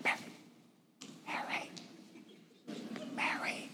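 imitating a hushed, voiceless call across the room; a sister calling softly from the kitchen. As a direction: call her name under your breath, trying not to be heard. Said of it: "Martha from the kitchen going".

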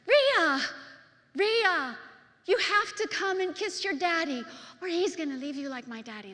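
put-on high, loud shout of a frantic three-year-old; fearful child's blurt. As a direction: blurt it out urgently, calling the name. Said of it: "I just blurted out".

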